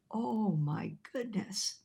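soft slow whisper of amazement; awed whisper. Retold or imitated. Preacher whispering in awe to the pastor blinded by glory. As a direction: breathe it out in hushed awe.

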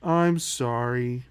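slow, drawn-out, low sorry; mock-contrite child ordered to apologize; forced sincere apology. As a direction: drag out a kid's apology as if you mean it. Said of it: "Say it like you mean it".

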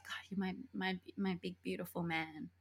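soft, hushed doting; quietly besotted, played for laughs; mock adoring. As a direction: coo it very softly to a lover. Mimics her doting on him.

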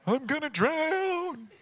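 high, soft, drawn-out mock wail; mocking whine. Sleepy Jesus mimicking the disciples, mocking whine. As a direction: stretch it into a small whiny imitation.

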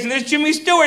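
usual speaking voice

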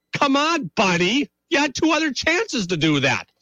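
high mock-whiny voice of the jilted exes; exes complaining. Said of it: "They're saying".

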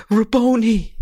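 cried out. Acted. Mary recognizing Jesus at the tomb, joyful loud cry. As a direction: call out his name in joy. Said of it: "she cried".

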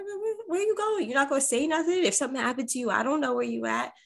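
high, pressing where-are-you-going questions; worried partner firing off questions; anxious demanding questions. Retold or imitated. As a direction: nag him anxiously about where he's going.

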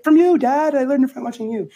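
squeaky imitation of the ad's guilty kid; hammed-up boy confessing to his father. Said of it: "And he's like".

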